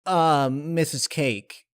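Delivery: slow, drawn-out uneasy call; unsure, stretched address; calling back, worried; hesitant Rainbow Dash. Acted.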